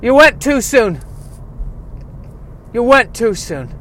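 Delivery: high wailing spirit voice; eerie beckoning, loud and high; ghostly call. Acted. Phil dragging the dead man's spirit.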